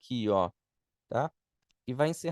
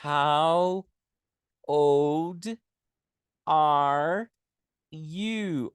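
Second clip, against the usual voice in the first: slow word by word; 4 dB louder, much slower than usual. Shown as a demonstration. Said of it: "Vamos escrever aqui".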